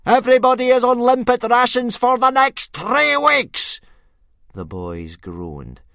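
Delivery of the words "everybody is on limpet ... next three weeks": much higher, much louder roar; furious shout. A narrator's reading. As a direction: punish them all with one blast. Gobber punishing the boys.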